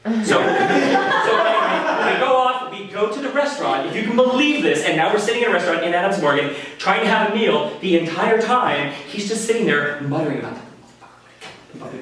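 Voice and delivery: ordinary delivery, nothing marked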